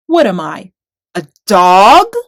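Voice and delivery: loud booming roar; thundering giant. A narrator's reading. Goliath thunders at the tiny challenger. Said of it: "thundered mean old Goliath".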